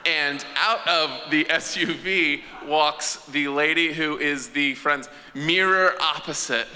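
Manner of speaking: usual speaking voice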